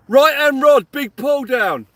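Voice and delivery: loud, high burst of excitement; excited shout. Real, not acted. Angler shouting to viewers that his rod got a bite, loud and excited. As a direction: call the bite like it's a big moment.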